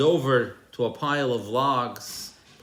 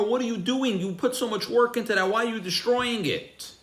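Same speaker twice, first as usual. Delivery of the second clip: high-pitched, aghast questioning; horrified protest; 10 semitones higher than usual. Retold or imitated. City visitor to farmer cutting down the stalks, horrified. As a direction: retell a bystander's horror, pitched right up. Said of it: "watches in horror".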